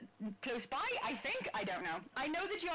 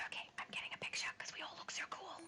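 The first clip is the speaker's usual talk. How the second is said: whispering; 8 dB quieter, faster than usual. Real, not acted. Quiet aside while taking screenshot.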